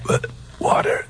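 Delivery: voiceless and soft; faint whisper. Acted. Semi-conscious man begging his friends for water, faint whisper. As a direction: mumble it half-conscious.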